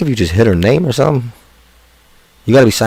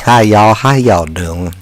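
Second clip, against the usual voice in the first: Southern drawl; much slower than usual. Retold or imitated. Greeting the chat like a Southerner, Southern drawl. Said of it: "Southern drawl".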